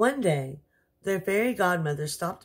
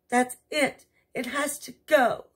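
commanding queen; 8 semitones higher, 3 dB louder than usual. Acted. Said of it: "commanded the queen".

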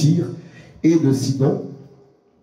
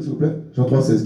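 ordinary talk, nothing marked

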